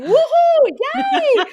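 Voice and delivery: high, loud excited whoops; grandma cheering. Retold or imitated. Grandma cheering in conga line.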